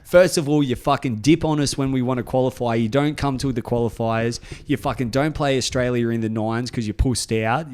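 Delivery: ordinary talk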